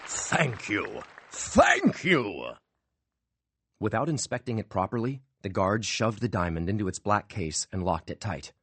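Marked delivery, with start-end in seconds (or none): 0.1-2.5 bow and soak it up; mock-humble magician. A narrator's reading. Magician bowing to the crowd, mock humble.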